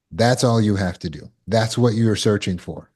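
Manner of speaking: ordinary delivery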